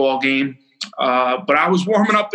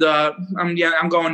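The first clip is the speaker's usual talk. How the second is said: ordinary delivery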